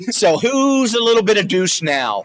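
high, slow taunt with "who's" drawn out; triumphant comeback. Acted. Husband turning wife's phrase back on her, drawn-out high taunt.

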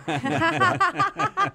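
laughs out high at the joke; real laughing burst up high; bursting into high laughter. Real, not acted.

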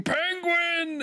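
high-pitched imitation of an excited scream; mock scream. Imitating Aang's introduction for the co-host, mock scream. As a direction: squeal it out, imitating an overexcited character. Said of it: "and then screaming".

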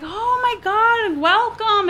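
high, drawn-out gushing greeting; delighted welcome. Retold or imitated. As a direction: imitate an overjoyed host, slow and high. Retells the couple's delighted welcome.

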